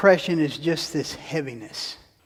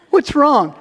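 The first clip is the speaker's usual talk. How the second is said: higher, louder, pleading what's wrong; mock concerned asker; 8 semitones higher, 6 dB louder than usual. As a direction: act out the prompted question. Imitating someone begging attention.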